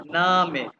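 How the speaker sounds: ordinary delivery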